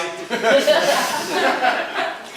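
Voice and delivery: a high burst of laughter. Real, not acted. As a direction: laugh at your own joke. Laughs at own joke in toast.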